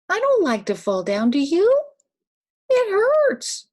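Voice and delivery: hammed-up whiny hurt; mock hurt complaint. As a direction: play up the pain of a fall for a child. Grandma acting out falling pain.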